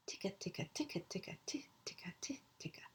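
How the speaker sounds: soft rhythmic ticking that fades to a whisper; whispered drum beat. Retold or imitated. Teacher to class, imitating drum rhythm, softly whispered. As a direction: tap out the beat under your breath.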